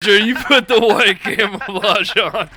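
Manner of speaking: loud order broken by laughter; laughing order. Real, not acted. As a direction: shout it through a laugh. Barks order while laughing.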